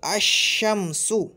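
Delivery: अशम्सु said very slowly, sh doubled; slow demo. Shown as a demonstration. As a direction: spell अशम्सु out at a crawl. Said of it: "जैसे".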